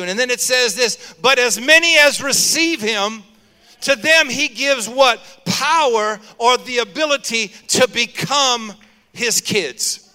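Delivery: loud, rising sermon delivery; full-force preaching. Acted. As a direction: preach it out to the congregation. Preaching climax.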